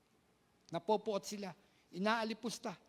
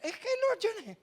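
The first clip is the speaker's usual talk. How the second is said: high, loud falsetto quote; high mock voice; 13 semitones higher, 5 dB louder than usual. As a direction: squeak the line. Retelling Ate Nicole.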